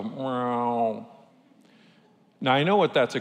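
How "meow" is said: cat meow. Retold or imitated. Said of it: "he'll look at me and just go".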